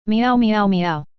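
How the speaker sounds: slow, drawn-out meowing like an upset cat; mock cat yowls; cat meows. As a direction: meow slowly like a cat in protest. Cat explodes at the male-cat idea.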